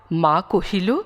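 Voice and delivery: usual speaking voice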